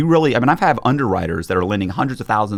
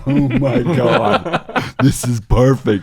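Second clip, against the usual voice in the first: laughing; 4 semitones higher, 4 dB louder, much slower than usual. Retold or imitated. Inner thought retold while laughing.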